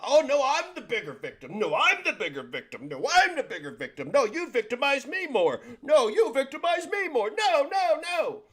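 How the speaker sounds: high, whining bigger-victim voice; whiny victim parody. Retold or imitated. Mocking people who compete to be victims, high whine. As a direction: whine like everyone is out-suffering each other. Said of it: "You sound like Queen of Spades now".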